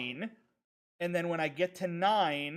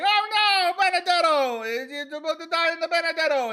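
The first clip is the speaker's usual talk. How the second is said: much higher, louder squeaky cartoon voice; cartoon character panic; 11 semitones higher, 9 dB louder than usual. Retelling the Homestar video to chat, squealing in a high cartoon voice. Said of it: "he's like".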